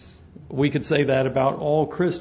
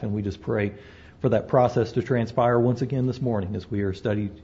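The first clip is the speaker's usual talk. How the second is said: quick, softer murmured prayer; pulpit prayer; 5 semitones lower, 4 dB quieter, faster than usual. Real, not acted. Pastor leads the congregation in prayer, hushed and quick. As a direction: pray it fast and hushed, head bowed.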